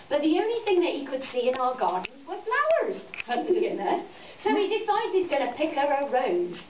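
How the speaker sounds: usual speaking voice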